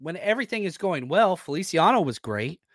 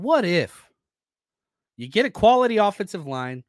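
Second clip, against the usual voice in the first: ordinary delivery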